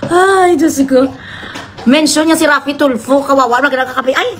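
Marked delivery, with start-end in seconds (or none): none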